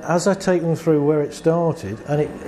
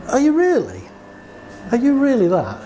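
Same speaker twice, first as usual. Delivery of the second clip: incredulous challenge; 9 semitones higher than usual. Retold or imitated. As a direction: push back in open disbelief. Therapist challenges client's self-insult.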